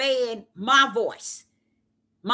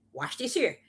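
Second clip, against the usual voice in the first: ordinary talk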